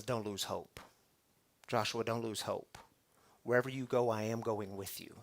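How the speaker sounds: soft, low, gentle reassurance; whisper-close comfort from God; God comforts Joshua, soft and low; tender promise. Acted. God's promise voiced softly.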